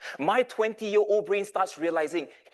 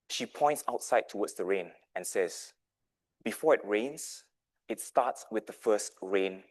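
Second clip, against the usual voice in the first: gentle mom; 5 semitones lower than usual. Retold or imitated. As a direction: coax him like a caring mother. Mom gently explains Ali's loss.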